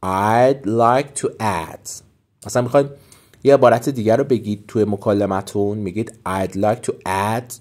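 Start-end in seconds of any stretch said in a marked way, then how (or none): 0.1-1.9 very slow, careful teacher read; slow model phrase. Shown as a demonstration. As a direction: draw each word out for learners. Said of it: "teacher models phrase".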